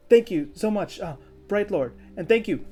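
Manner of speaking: high, loud, overwhelmed little thank-you; whiplashed child's thanks. Acted. Flustered kid thanking the noble visitors, whiplashed and excited. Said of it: "whiplashed and just like".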